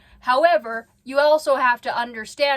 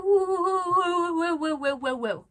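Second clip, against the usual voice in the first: woo swooping high like a rollercoaster; mock the wild mood swings vocally; swooping woo sounds; 6 semitones higher than usual. Describing Amber's emotions, high swooping woos.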